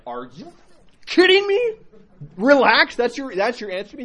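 high-pitched, indignant disbelief; incredulous fuming. Retold or imitated. Told to relax by the monk, incredulous fuming. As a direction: burst out in retold outrage at the answer. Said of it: "ten seconds of just fuming".

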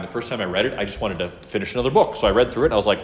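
normal talking voice

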